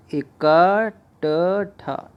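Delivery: इकट्ठा stretched syllable by syllable, very slow; slow spelled-out word. Shown as a demonstration. Teacher slowly sounds out इकट्ठा for students. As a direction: draw out इकट्ठा to show each letter. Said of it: "ये सही है".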